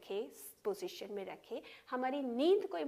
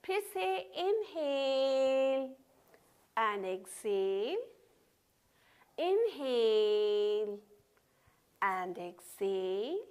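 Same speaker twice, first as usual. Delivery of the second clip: long sustained vowels, very slow; drawn-out breath cues; 3 dB louder, much slower than usual. Real, not acted. Yoga teacher pacing opposite circles, drawn-out cues. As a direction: hold each word for a full breath.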